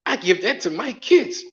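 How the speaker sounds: high-pitched imitation of an offended woman; acted-out haughty rejection; mock scorn. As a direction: scoff at a lowball offer in a high voice. Acts woman scorning his offer.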